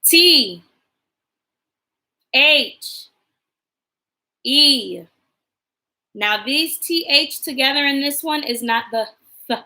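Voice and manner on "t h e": letter by letter, clear and paced; spelling aloud. Shown as a demonstration. Teacher spelling a sight word for the class, letter by letter. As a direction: spell it out slowly for little ones. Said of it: "Next one".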